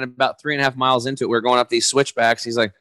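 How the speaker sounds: normal talking voice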